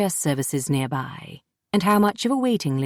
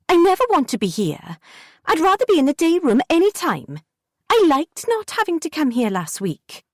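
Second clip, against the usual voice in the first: put-on a kid insisting she never wants to come; pitched-up stubborn protest; defiant girl Jessie; 11 semitones higher than usual. A narrator's reading.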